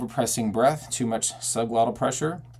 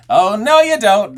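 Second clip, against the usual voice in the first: high, loud belted objection; emphatic belted cry; yelled protest; 9 semitones higher, 11 dB louder than usual. Shown as a demonstration. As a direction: shout it to stop someone. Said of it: "an expression or a need to yell".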